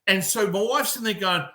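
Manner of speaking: normal talking voice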